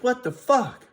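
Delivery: sudden high, loud what the fuck; startled outburst. Retold or imitated. Seeing the sheriff parked outside his house, startled outburst. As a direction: blurt it out in disbelief. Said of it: "I'm like".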